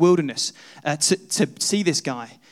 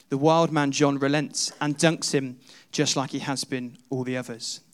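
ordinary talk, nothing marked

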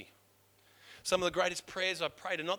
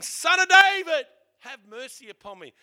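crying out; 9 semitones higher, 15 dB louder than usual. Retold or imitated. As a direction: call out across a crowd for mercy. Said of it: "he starts singing".

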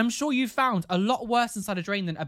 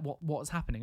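hushed, lower imitation of a worried housemate; retold whisper; 4 semitones lower, 6 dB quieter than usual. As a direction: drop to a soft, confused murmur. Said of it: "Like, whispers to me".

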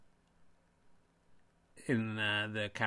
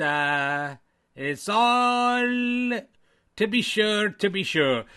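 stage Irish that is slipping a little; Irish accent, wobbling toward Welsh; slower than usual. Acted. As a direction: pull the Irish accent back into line. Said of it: "You going Welsh?".

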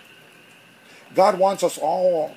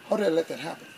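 softer repeat of How did I let that happen; quiet self-reproach; 7 dB quieter, much faster than usual. Retold or imitated. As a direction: ask it again, much softer, to yourself. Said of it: "I had to ask God for forgiveness".

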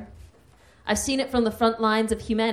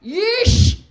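much higher, louder mock Yeesh; mock shout; 13 semitones higher, 9 dB louder than usual. Comic shouting at two bus bigots in her head, mock shout. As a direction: fling out an exasperated Yeesh. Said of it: "I shouted".

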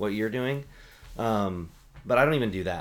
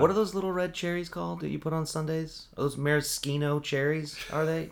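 higher-pitched put-on questioning voice; mock questioner; 7 semitones higher than usual. Asking about sundae cherries, mock high-pitched voice. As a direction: ask it in a high, mocking voice.

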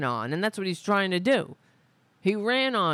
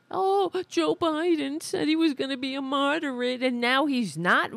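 higher, whiny imitation of complainers; mock whining; 6 semitones higher than usual. Host mocking conservative critics, higher whiny imitation. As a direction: whine it like a sulky critic. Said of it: "these people are whining and crying about".

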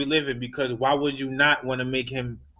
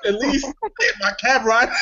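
amused mock plea; 9 semitones higher than usual. Joking along, laughs right after.

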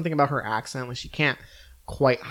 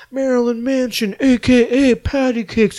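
high, loud showy nickname roll call; rattling off nicknames; 10 semitones higher, 8 dB louder than usual. Retold or imitated. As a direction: announce each nickname like a hype intro. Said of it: "they basically rattle off".

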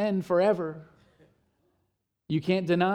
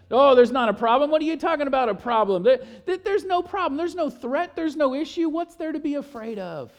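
higher and louder, brushing it off; mock dismissive denial; 6 semitones higher, 3 dB louder than usual. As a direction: wave the problem away, fake breezy. Mocking denial voice.

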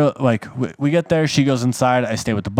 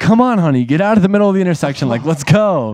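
higher, louder, fast urging to move; urgent hurry; 5 semitones higher, 4 dB louder than usual. Retold or imitated. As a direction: hurry your partner out of traffic. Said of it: "I was like".

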